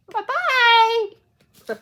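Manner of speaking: high, loud sing-song bye-bye; mock cheerful farewell. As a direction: wave off the loser gleefully. Rival loses the scythe competition.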